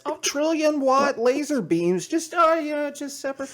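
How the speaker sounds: high, breezy mock voice; cavalier mockery. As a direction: toss it off in a high, careless parody. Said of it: "cavalierly Michio Kaku says".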